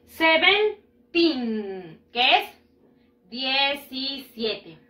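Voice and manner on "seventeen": "Seventeen" very slowly in two separated parts; stretched-out "Seventeen", the last syllable held; same teen ending. Shown as a demonstration. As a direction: model "Seventeen" with a pause before a long teen. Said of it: "La misma pronunciación va a ser aquí".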